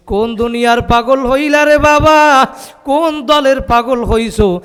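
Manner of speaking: loud, high, rising challenge to the crowd; impassioned rebuke. Real, not acted. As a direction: cry out the question as a reproach. Rebuking the crowd with repeated question. (উঁচু গলায় তিরস্কার)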